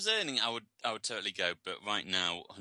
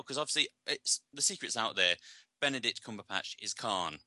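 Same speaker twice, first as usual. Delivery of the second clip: ordinary talk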